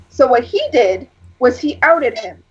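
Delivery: ordinary delivery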